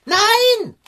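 hoch und laut erschrocken; erschrockenes Nein. Acted. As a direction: fahr ertappt hoch. Erschrickt, ertappt. (erschrockener Aufschrei)

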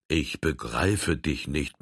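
taken aback. A narrator's reading. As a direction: say it slowly, caught off guard. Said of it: "meinte der Rote betroffen".

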